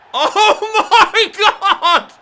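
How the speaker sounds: loud, high laugh through the words; laughing disbelief. Real, not acted. Gamer laughs after a stunning goal, laughing shout. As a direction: crack up in amazement.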